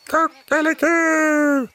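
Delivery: high, slow, drawn-out rooster crow; long high cock-a-doodle-doo. A narrator's reading. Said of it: "gal tuppen".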